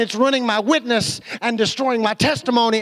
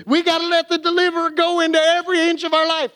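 hyped-up preaching; 8 semitones higher, faster than usual. Shown as a demonstration. Pastor mocks how churches would shout it, in a fired-up preacher's cry. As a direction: whip the crowd into a frenzy. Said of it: "I could preach that last statement I just made".

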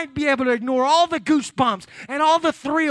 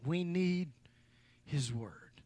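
much softer and lower, almost breathed; hushed intimate; 5 semitones lower, 12 dB quieter, much slower than usual. Real, not acted. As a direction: bring "We need his word" down to a hush. Same line, dropped to hush.

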